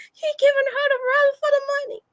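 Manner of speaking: high, fast giggling mock of the crowd; giggling onlookers. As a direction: tease her like the laughing parents. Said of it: "They was".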